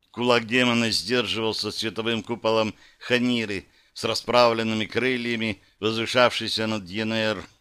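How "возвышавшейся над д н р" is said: plain reading voice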